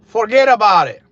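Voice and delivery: "Forget about it" shouted high in a Connecticut accent. Real, not acted. To his viewers, a sudden loud Connecticut accent. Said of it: "There goes my Connecticut accent".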